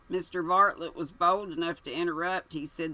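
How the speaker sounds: ordinary delivery, nothing marked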